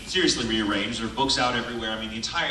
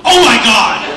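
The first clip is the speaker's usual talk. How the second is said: high, loud laughing squeal of alarm; shocked shriek; 12 semitones higher, 17 dB louder, slower than usual. Retold or imitated. To Brina on the phone, a shrieking laughing gasp. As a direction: shriek in mock horror.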